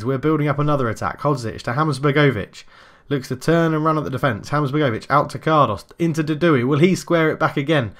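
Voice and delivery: brisk running match commentary; play-by-play commentary. Acted. Live football game commentary.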